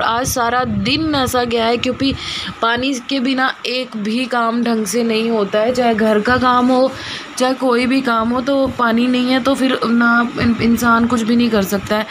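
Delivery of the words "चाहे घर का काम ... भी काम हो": ordinary talk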